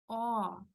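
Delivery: O opened wide and projected up, slightly through the nose. Shown as a demonstration. Teacher demonstrates the throat position, open projected O. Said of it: "más arriba, incluso, eh, hasta por la nariz".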